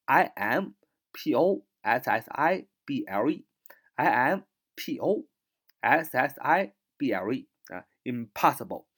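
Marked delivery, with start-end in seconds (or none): 1.1-7.5 letters spelt out slowly one by one; slow spelling. Shown as a demonstration. Teacher spelling impossible for the class. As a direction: spell each letter deliberately. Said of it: "spelling out the word".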